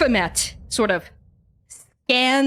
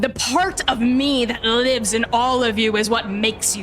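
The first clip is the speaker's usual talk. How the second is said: slow, grand godlike proclamation; proud divine father. Acted. Divine father claiming credit for his children's strength, proud and grand. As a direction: proclaim it like a god, word by word.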